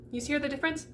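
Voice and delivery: ordinary talk, nothing marked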